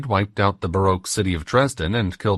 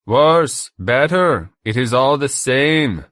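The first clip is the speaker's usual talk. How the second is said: loud, higher German tormentor; German accent; 6 semitones higher, 6 dB louder, much slower than usual. A narrator's reading. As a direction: dismiss it loudly with a German accent. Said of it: "The accent was German".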